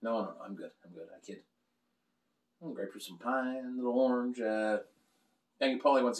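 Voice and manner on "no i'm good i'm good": usual speaking voice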